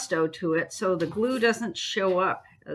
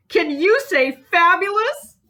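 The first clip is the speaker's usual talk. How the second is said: much higher and louder, playful showing-off voice; playful sing-song; 10 semitones higher, 7 dB louder than usual. Acted. Teacher showing off her glued book to viewers, playful high voice. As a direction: gush it like a game show host.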